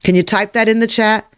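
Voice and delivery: usual speaking voice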